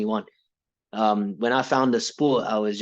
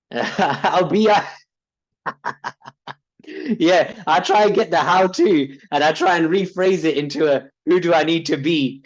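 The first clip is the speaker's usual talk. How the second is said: laughing; 6 semitones higher, 5 dB louder than usual. Real, not acted. Laughing while recounting his trick.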